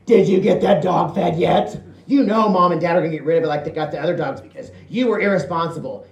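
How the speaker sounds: the sister nagging about the dog. Retold or imitated. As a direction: rattle off the nagging.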